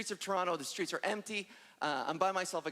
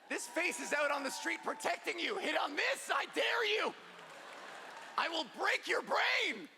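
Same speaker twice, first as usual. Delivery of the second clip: mock tough challenge; 8 semitones higher than usual. Taunting catcallers with mock threat.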